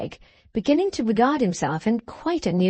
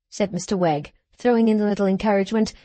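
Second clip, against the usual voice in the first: ordinary delivery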